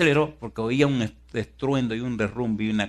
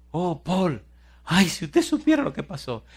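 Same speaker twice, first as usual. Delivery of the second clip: high, plaintive outpouring; imitated distressed woman; 8 semitones higher, 4 dB louder than usual. Trapped woman confides in her rescuer, high and plaintive. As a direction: lament in a raised pitch.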